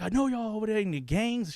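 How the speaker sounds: high imitation of mother's accusation; accusing mom. Mom accusing son of gang life, high-pitched imitation. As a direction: mimic a mom who knows it already. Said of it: "She's like".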